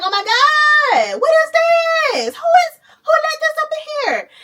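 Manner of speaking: high hammed-up shout of horror, slowed and cracking with laughter; panicked shout. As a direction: scream in mock panic at the green baby. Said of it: "Everybody like".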